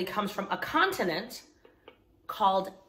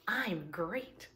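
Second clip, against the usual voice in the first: soft answer; 2 semitones lower, 10 dB quieter than usual. Shown as a demonstration. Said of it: "I want you to respond by saying".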